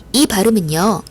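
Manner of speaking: ordinary talk, nothing marked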